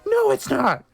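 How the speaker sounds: high, loud furious denial; angry shout. A narrator's reading. Ebony shouts at Vampire, high angry denial. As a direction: shout it back in anger. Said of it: "I shouted angrily".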